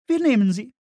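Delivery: hohe, laute Frauenstimme; Frauenfigur. Acted. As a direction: entscheide laut und hoch als Frau. Woman voiced in narration. (hohe, laute Frauenstimme)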